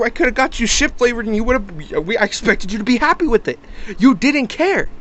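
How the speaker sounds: high-pitched, exasperated protest; indignant retort. Retold or imitated. Buyer arguing back over the chips, indignant. Acted angry retort in argument.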